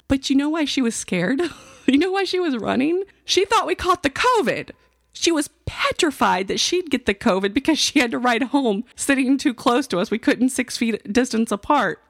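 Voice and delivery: high-pitched, hammed-up disbelief; mock incredulity. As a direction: exaggerate it in a high, exasperated voice. Mocking retelling of daughter's covid fear.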